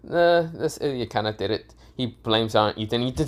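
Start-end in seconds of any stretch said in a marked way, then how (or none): none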